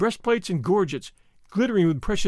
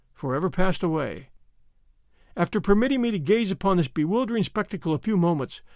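plain reading voice

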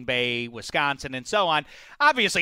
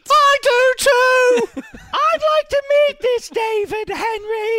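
loud falsetto English dame; hammed-up Mrs. Doubtfire-style English voice; mock English accent; 6 dB louder than usual. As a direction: shout it in a silly high English accent. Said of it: "I learned my English accent".

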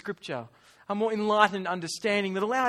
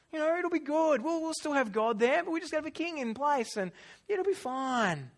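higher-pitched, dismissive reassurance; acted chill-out excuse, pitched up; breezy persuasion; 6 semitones higher than usual. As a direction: talk them round, making light of it. Voicing Israel's casual excuses for a king.

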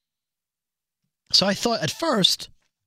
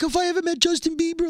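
much higher, gushing mock fan; fangirl voice; 10 semitones higher than usual. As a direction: mimic a starry-eyed fan girl. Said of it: "you're like".

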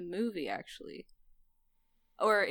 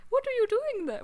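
high, raised mock scolding; high-pitched indignant shopkeeper lady; imagined shop lady; 6 dB louder than usual. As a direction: voice a lady catching someone out, pitched way up. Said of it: "what I imagine in my head that the lady said".

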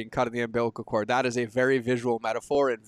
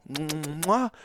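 blowing an air kiss; 2 semitones higher, 3 dB quieter than usual. Real, not acted. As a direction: blow a kiss to the listeners. Sign-off after I love you all.

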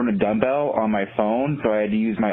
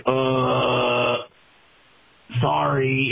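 retold awkward apology; 4 semitones higher, much slower than usual. Retold awkward apology to startled roommate.

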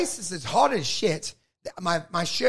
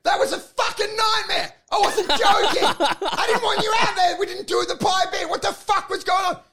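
much higher, loud ranting; angry shout; 11 semitones higher, 4 dB louder than usual. Retold or imitated. Comedian berating the pie man after the show. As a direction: shout the whole rant. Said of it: "I go".

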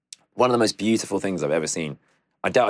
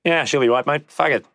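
carefree Australian, higher and louder; mocking Australian nonchalance about deadly spiders in an Australian accent; Australian attitude; 6 semitones higher, 4 dB louder than usual. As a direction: toss it off like a no-worries Aussie. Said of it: "that's the attitude".

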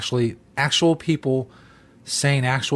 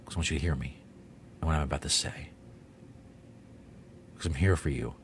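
soft, mock-soothing intimate voice; mock tender; 8 semitones lower, 12 dB quieter, faster than usual. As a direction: lure them in gently. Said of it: "get real close".

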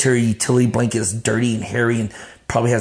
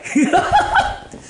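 high breathy burst of laughter; real high giggle; amused laugh. Real, not acted. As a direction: crack up at your own line. Laughs at his own joke.

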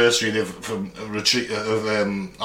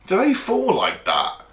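slow, higher-pitched baffled question; retold disbelief; 7 semitones higher, slower than usual. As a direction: recall an astonished question, drawn out. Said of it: "I was like".

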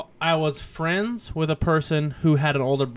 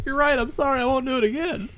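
put-on higher voice, a scared kid caving in; mock pleading kid; 8 semitones higher, faster than usual. Kid pinned to the wall begs, in a mock higher pleading voice. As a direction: imitate the pinned kid giving in.

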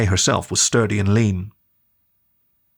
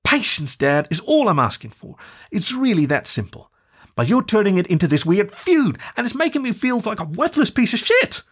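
put-on young woman pleading, higher and hurt; upset daughter; 10 semitones higher than usual. Daughter on a call pleading with her dad, higher and hurt. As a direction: push back at your father, close to tears.

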